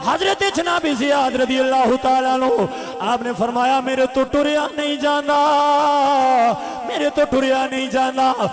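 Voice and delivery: loud, ringing sermon chant; full-force preaching. A narrator's reading. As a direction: preach the battle story at full volume. Preaches the wounded man's return.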